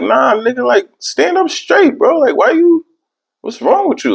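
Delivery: very high, mock-alarmed friend voice; high hammed-up friends asking what's wrong. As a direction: squeal at him like worried friends. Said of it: "And they like".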